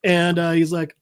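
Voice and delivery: ordinary talk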